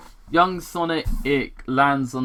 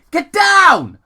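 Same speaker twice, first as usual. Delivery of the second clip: very high, loud urgent shout; exclaimed warning; 11 dB louder than usual. Acted. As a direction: yell it to save the kid. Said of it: "Longclaw explained, I mean, exclaimed".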